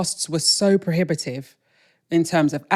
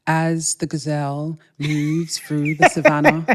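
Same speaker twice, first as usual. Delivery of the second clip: slow, hushed David Attenborough-style narration; nature documentary narrator; slower than usual. Pretend. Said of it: "I'd be like".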